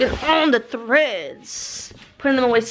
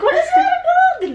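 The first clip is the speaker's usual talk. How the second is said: much higher, louder, slow startled laugh; startled shriek; 6 dB louder than usual. Retold or imitated. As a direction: shriek at the scary reflection, laughing. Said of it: "I was like".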